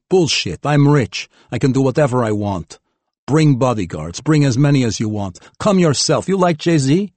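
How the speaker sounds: high, loud and bratty, building; loud petulant demand. Acted. Spoiled youth demanding Berlin trip.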